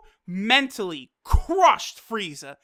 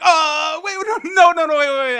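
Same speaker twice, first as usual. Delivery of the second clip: loud, high panicked backpedal; imitating a desperate, raised-voice beg; Frieza begging; 6 semitones higher, 7 dB louder than usual. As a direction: blurt frantic pleas in a mock panic. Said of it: "get down on his hands and knees and beg".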